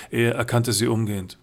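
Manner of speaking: ordinary delivery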